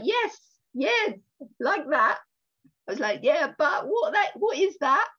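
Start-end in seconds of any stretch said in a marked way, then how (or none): 0.0-2.2 high, loud excited yeses; eager agreement. Retold or imitated. Retelling her lunch chat to the host, excited and high. Acting out own eager reaction. 3.2-5.1 high, loud and laughingly baffled; excited questioning. Retold or imitated. Pressing a friend to explain his calm, excited and loud. Retelling own eager puzzled question.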